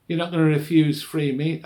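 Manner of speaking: loud, coaxing call; goading guard. Retold or imitated. Guards pressing the doctor to join in, loud goading.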